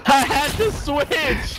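high slow panicked wail; panicking gamer. Real, not acted. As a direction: yelp it out in panic. Panicked reply under pressure.

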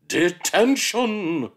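loud, very slow bellow; drawn-out shout. Acted. Miss Seeth bellows at the cheeky child, drawn out. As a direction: stretch the word in a roar.

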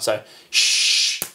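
unvoiced shush for the beat; quick "shh" hiss; sh sound. Shown as a demonstration. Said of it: "make a sh sound".

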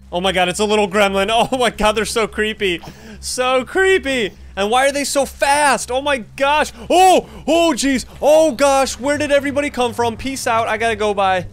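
high, loud panicked squealing; real high-pitched panic, shouting on the jeez. Real, not acted. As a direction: shriek as the creatures rush you. Panicked by fast creepy gremlins.

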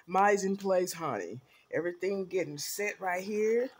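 normal talking voice

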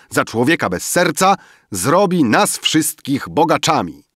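loud, high-pitched address to guests; public announcement. A narrator's reading. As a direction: announce it grandly to the whole gathering. Emil announces to the gathered guests. (głośne ogłoszenie wobec zebranych)

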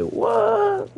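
high squeaky mock disbelief; imitating his own stunned reaction; retelling the breakup, a high shocked what. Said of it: "I was like".

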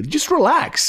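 high, lightly put-on soothing voice; mock dentist. Imitating the dentist to the patient, high mock soothing. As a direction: parody the dentist's calming line.

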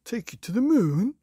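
loud fake alarm from the scheming husband; Mr Twit exclaiming. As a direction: feign horror at the idea. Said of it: "exclaimed Mister Twit".

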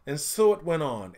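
ordinary delivery, nothing marked

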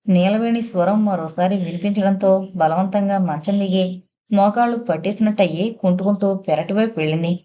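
usual speaking voice

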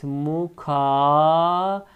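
slow drawn-out "Mukarenet"; syllable by syllable; slow model reading. Shown as a demonstration. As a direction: say it slowly for students to repeat. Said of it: "Diğer kelimemiz mukarenet".

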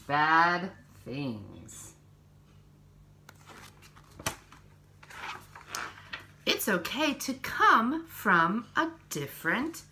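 slow, gentle picture-book reading; reading to children. Acted. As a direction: read each page to little ones, unhurried.

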